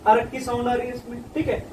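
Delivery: ordinary talk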